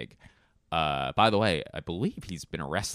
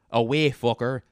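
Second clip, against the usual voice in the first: louder Northern Irish jab; Northern Irish accent; 4 semitones higher, 6 dB louder than usual. Acted. Trying out Northern Irish, mock accent. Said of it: "Northern Irish".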